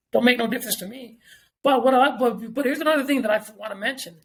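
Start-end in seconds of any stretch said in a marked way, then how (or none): none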